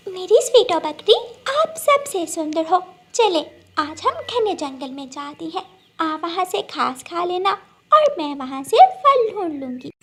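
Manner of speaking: a lightly voiced happy sparrow; sparrow Gudiya. Gudiya inviting the goat into the forest, cheerfully.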